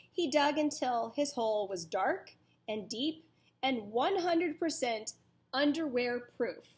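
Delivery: ordinary delivery, nothing marked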